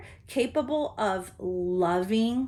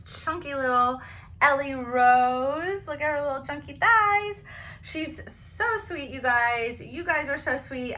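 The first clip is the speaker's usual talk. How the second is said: higher, cooing doll talk; aww; 4 semitones higher, 3 dB louder than usual. Real, not acted. Cooing over her baby doll.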